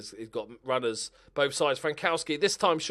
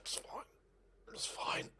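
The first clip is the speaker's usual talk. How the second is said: whisper; 11 dB quieter than usual. Real, not acted. Muttering after a missed chance.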